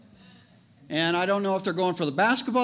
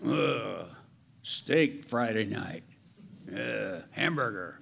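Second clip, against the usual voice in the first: low, slow, mock-disgusted cow voice; a low, softer put-on cow groan; grumbling cow; 9 semitones lower, 6 dB quieter, slower than usual. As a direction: grumble like a cow dreaming of beef. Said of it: "looking at the cow going".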